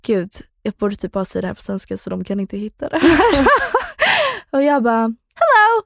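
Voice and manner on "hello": much higher, louder chirpy hello; a bright high phone greeting; the high-pitched hello she always answers with; her usual high hello. Retold or imitated. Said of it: "som jag alltid gör".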